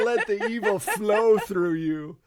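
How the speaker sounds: loud, hammed-up evil emperor, half laughing; Palpatine. Teasing a player who turned evil, mock Palpatine. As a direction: goad them like a gleeful dark lord. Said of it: "[name] turned into Palpatine".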